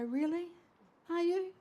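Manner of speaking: surprised delight. Retold or imitated. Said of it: "And she'd go".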